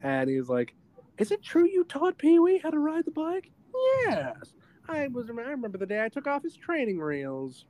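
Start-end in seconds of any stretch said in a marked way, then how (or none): 1.2-3.5 much higher, far-off reporter voice; mock reporter. Reporter asking Francis about Pee-wee, high voice. 4.0-7.6 higher, puffed-up boasting voice; smug Francis. Retold or imitated. Francis boasting to reporters, smug. Voicing Francis eating up fame.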